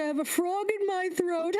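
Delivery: high, put-on royal voice; high king voice. The king jokes to his guests, in a high voice. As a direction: joke it out in a high voice. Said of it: "You could say".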